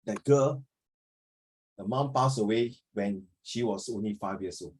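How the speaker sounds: really moved, voice dropping away; choked up. Real, not acted. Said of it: "I'm a bit emotional".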